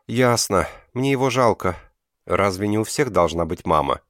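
ordinary delivery

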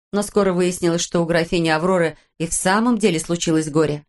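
ordinary delivery, nothing marked

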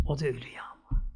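hushed solemn whisper. Real, not acted. Solemn reminder of death.

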